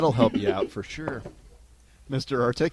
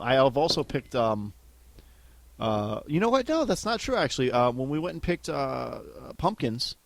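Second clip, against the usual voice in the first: ordinary talk, nothing marked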